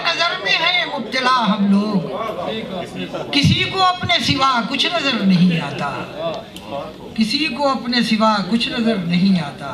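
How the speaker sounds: measured Urdu verse delivery, pitched above plain talk; mushaira poetry recitation. Acted. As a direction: declaim each couplet slowly, drawing out the phrases.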